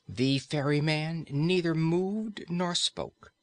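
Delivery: usual speaking voice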